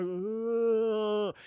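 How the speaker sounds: imitated zombie moan; drawn-out undead groan. Imitates zombie Pete going for Shaun.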